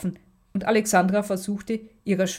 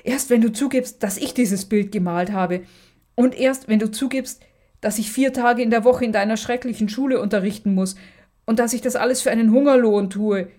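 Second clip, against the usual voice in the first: higher and louder, frantic accusation; beside herself, shouting; 4 semitones higher, 5 dB louder than usual. Acted. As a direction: yell every condition at her sister. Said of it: "schrie Magda außer sich".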